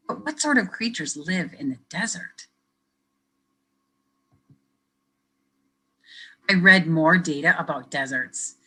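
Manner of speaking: lively picture-book reading; reading to children. Acted. Teacher reading a picture book to kids, lively storytelling. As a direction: read it brightly for young listeners.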